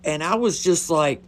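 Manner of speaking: ordinary delivery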